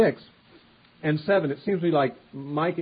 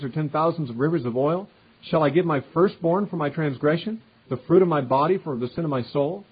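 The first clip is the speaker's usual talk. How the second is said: raised, louder reading of the verses; scripture reading; 3 semitones higher, 4 dB louder than usual. Acted. Preacher reading the people's answer to God, raised and declaiming. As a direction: read the questions aloud with weight.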